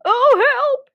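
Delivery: high, loud cry for help; hammed-up fright; panicked fox. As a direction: yelp in storybook alarm.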